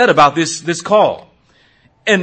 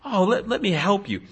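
mock sympathy; 3 semitones higher, 6 dB quieter than usual. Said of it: "empathize with those who are upset and say".

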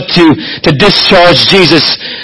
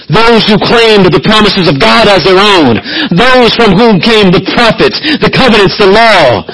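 higher, driving preacher build; preaching at full force; 7 semitones higher than usual. Acted. Preacher lists Israel's privileges, rising fervent preaching. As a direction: lift it to the pulpit climax.

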